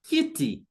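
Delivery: kiti with high KI, falling TI; stress on first syllable. Shown as a demonstration. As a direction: lift the KI in kiti, let TI drop. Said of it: "stress will be on the first syllable".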